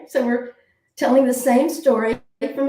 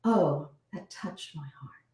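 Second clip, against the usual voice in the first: soft, slow and heartfelt; tender, slow, hushed; touched heart; 4 semitones lower, 7 dB quieter, slower than usual. Shown as a demonstration. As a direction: let it land softly in the chest. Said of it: "but we say".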